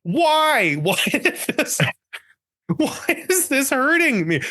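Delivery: high, drawn-out mock wail of disbelief; shocked protest. Patient reliving the needle hit, shocked protest. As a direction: cry out in baffled pain, half laughing.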